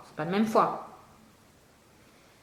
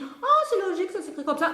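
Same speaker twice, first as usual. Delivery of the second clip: oh, c'est logique, higher, mock naive; 9 semitones higher than usual. The pupil thinking spelling is logical, naive and higher. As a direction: play a pupil who thinks it is easy. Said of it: "Alors tu te dis".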